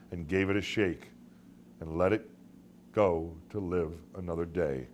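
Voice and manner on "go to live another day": usual speaking voice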